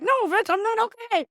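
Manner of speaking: high, fast, loud mock outburst; mock furious snap. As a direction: fire it back, hammed-up fury. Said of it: "And I'm like".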